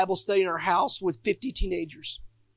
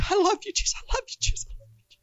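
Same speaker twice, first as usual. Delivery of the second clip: high, tearful plea fading into a whisper; crying to Jesus, trailing to a whisper; tearful worship; 12 semitones higher, much faster than usual. Retold or imitated. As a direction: relive the weeping prayer until the voice gives out. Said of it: "I was crying".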